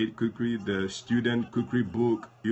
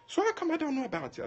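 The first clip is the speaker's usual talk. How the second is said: much higher, quicker put-on pastor voice; puzzled pastor; faster than usual. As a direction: ask it like a baffled pastor. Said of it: "He was like".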